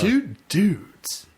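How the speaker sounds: high, hammed-up booming announcer voice; a failed go at a grand TV-voice baritone. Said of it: "I was trying to do [name]".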